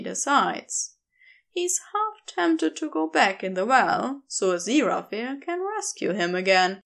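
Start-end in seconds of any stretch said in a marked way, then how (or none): none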